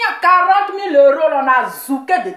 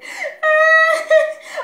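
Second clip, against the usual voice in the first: high mocking laugh-cry; 13 semitones higher than usual. Mocking the crying woman.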